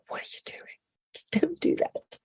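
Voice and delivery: whispered mock scolding of an actor; hushed, disbelieving plea to stop; exasperated whisper. Coach's exasperated aside to naughty actors.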